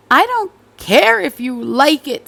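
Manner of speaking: stern father. Acted. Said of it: "said her father".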